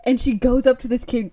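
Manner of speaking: normal talking voice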